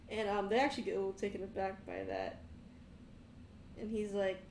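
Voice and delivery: normal talking voice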